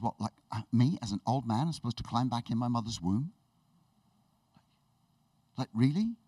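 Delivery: low, doubtful questioning; acted sarcasm. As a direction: play a mildly facetious sceptic. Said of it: "I don't know if he's just being sarcastic".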